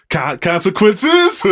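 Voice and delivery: high, drawn-out comic questioning; Key and Peele. Acted. Quoting a comedy sketch to co-host, mock-incredulous. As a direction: stretch it into an exaggerated, high-pitched query. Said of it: "off of Key and Peele episode".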